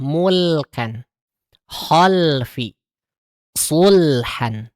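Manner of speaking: "mulkan, khalfi, sulhan" each l sounded clearly; clear lam examples. Shown as a demonstration. Teacher lists clear lam examples for mothers. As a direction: say each word crisply.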